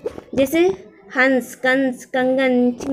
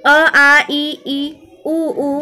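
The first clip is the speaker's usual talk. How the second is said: loud recitation; 5 dB louder, much slower than usual. Shown as a demonstration. Teacher reciting vowels aloud.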